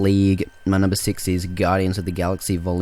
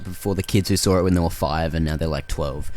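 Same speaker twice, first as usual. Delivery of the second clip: ordinary talk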